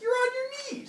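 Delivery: very high exasperated mock protest; comic squabbling accusation. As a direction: call out the obvious trick, voice soaring. Acted argument over the bull.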